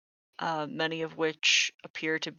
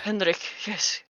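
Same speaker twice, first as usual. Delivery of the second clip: higher, nervous confirmation; flustered old man; 4 semitones higher than usual. Acted. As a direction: answer timidly as a scared old man. Frightened old man gives his name.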